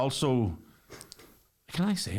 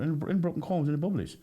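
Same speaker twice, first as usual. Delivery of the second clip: put-on begging kid in a higher Irish traveller accent; 7 semitones higher, faster than usual. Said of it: "Why is bubbly a lovely word in a traveller accent?".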